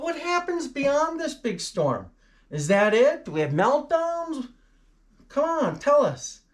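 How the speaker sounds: high-pitched, pleading eagerness; eager mock questioning. Presenter playing viewers begging for news, eager high voice. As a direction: press for the answer in a hammed-up high voice.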